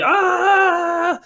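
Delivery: long, high, thin mock scream; internal scream. Fanboy playing it cool but screaming inside, high mock scream. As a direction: hold a high inner scream of panic.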